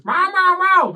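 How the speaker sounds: high, loud meows; high cat meows, half shouted; cat meowing. Acted. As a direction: meow at the other cat. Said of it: "Turns round to the other one and goes".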